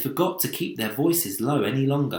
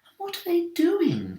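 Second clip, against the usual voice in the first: ladybird voice. A narrator's reading. Ladybird keeps asking.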